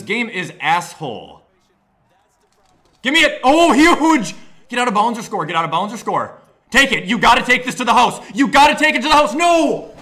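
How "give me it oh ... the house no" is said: high, loud urgent cheering; excited yelling. Real, not acted. Gamer screaming at his runner to score. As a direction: urge the runner on, faster and louder.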